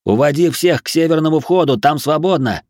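high, urgent call; shouted instruction. A narrator's reading. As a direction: shout directions across a crowd. Shouting instructions across crowd.

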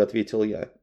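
ordinary delivery

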